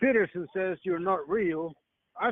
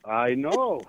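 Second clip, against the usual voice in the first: normal talking voice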